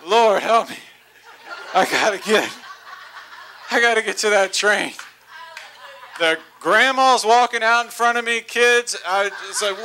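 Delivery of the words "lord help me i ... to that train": panting prayer. Retold or imitated. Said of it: "I'm breathing heavy. I'm panting".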